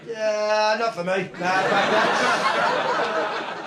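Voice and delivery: laughing mock ape. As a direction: brush off evolution like a lazy ape, laughing. Apes voiced refusing evolution.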